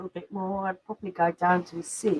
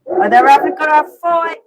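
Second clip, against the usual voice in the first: loud, higher-pitched cry of alarm; alarmed call; 6 semitones higher, 15 dB louder than usual. Real, not acted. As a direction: call out over fighting dogs. Dogs start fighting during stream.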